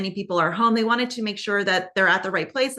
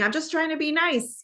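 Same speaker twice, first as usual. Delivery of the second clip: higher, lightly defensive imitation; mimicking Karen; 8 semitones higher than usual. Karen defending herself to John in the argument, higher mimicking voice. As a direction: mimic her pleading it, voice pitched up. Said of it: "She's saying".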